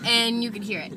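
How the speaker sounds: usual speaking voice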